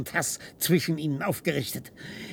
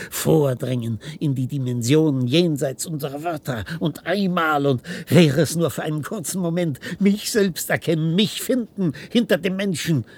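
louder, higher, rising rapture; ecstatic outburst; 4 semitones higher, 7 dB louder than usual. Acted. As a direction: break free with fervour. Ecstatic outburst about escaping language.

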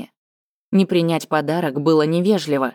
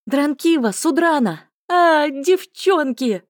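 high, slow joyful call; excited calling out; 11 semitones higher, slower than usual. Acted. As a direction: call out to friends with delight. Spots friends, calls out happily.

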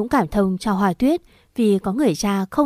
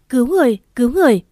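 fast, louder cry for help; panicked call for help; 4 semitones higher, 5 dB louder, much faster than usual. Acted. Brother collapsing at press conference, fast panicked louder shout for help. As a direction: yell it out fast as he falls.

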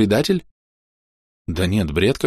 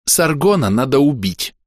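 firm, higher-pitched pronouncement; raised, resolute statement; sudden loud declaration; 7 semitones higher than usual. A narrator's reading. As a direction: announce the verdict abruptly. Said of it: "вдруг громко сказала Хоули".

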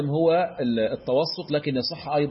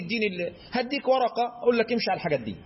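higher, fast mock student request; imitating the student; 5 semitones higher, faster than usual. Sheikh mimicking his student asking for a sheet, higher and quick. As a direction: play the eager student, quick and high. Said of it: "وتقول لي".

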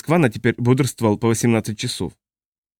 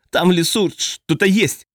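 breathless, high and slowed; out of breath, blurting in alarm; urgent panting report; 7 semitones higher, slower than usual. A narrator's reading. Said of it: "запыхавшись, выпалил он".

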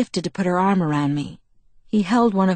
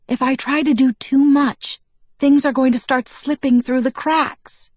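heated pleading; 7 semitones higher, 3 dB louder than usual. A narrator's reading. Alice arguing back.

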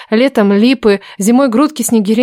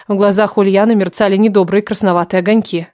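usual speaking voice

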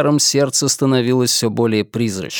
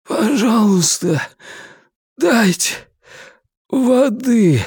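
high, slow cracking plea; broken voice; 9 semitones higher, slower than usual. Acted. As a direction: ask with a broken voice. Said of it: "надломленным голосом попросил он". (надломленный голос)

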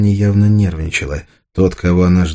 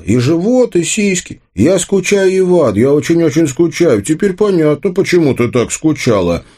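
mocking taunt; 11 semitones higher than usual. A narrator's reading. Arsen mocks Anya after realising.

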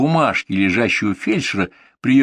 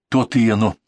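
ordinary delivery, nothing marked

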